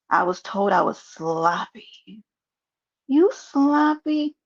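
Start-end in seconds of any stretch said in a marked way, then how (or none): 3.1-4.3 mock disbelief. Mocking the sloppy comment.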